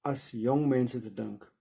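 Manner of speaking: steady, expressive reading of an Afrikaans novel; story narration. A narrator's reading. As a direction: read the chapter aloud like a storyteller. Reads Afrikaans novel aloud.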